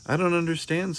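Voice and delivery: a hint of a puzzled little boy; child's voice. Child Arthur voiced in story.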